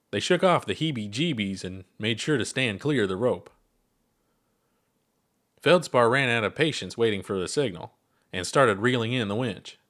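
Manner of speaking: plain reading voice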